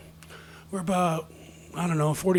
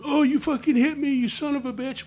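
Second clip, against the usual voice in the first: lightly mocking, higher-pitched whine of complaint; mock crybaby; 8 semitones higher than usual. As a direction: imitate an offended prima donna. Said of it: "pussies and crybabies and prima donnas".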